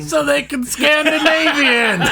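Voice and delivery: high, loud, laughing punchline; excited punchline. Real, not acted. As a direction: shout the punchline with glee. Delivering the joke punchline.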